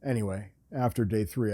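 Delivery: ordinary talk, nothing marked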